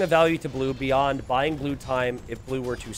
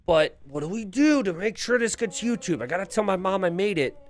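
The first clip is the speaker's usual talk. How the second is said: excited player voice; 6 semitones higher than usual. Retold or imitated. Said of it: "they're like".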